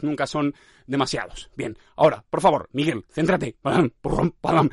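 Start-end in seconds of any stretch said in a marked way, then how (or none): 3.6-4.7 spoken thumps in fits and starts; vocalised knocks, one by one; jerky stumbling noises. Shown as a demonstration. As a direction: imitate a lurching, bumpy rhythm. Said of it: "Es que vamos así".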